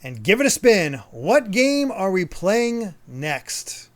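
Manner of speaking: loud, slow drawn-out reveal; game show announcer. Acted. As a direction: build suspense like a game show host. Game show style wheel reveal.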